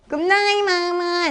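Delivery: high, sing-song goodnight; high little storybook voice; little monkey. Shown as a demonstration. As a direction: chirp it high like a small monkey. Little monkey puppet says goodnight.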